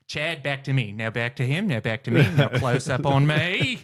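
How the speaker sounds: hammed-up Australian host directing a cameraman; broad Australian, stretching out the last words; Steve Irwin. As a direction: order the camera about as an Australian presenter. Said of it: "I would be Steve Irwin".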